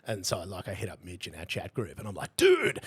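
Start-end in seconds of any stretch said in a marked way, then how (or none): 2.4-2.8 a high, loud excited "Dude"; squealing "Dude" to a mate. Retold or imitated. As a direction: call it out, thrilled. Excited message to Mitch.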